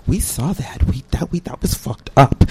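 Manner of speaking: low, soft, hushed support; confiding witness. Acted. Library employee coming forward to students, low confiding tone.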